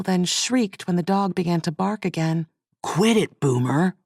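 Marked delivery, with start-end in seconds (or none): 2.8-3.9 mild yell at the dog. A narrator's reading. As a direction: bark the order at a dog. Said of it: "the man yelled at the dog".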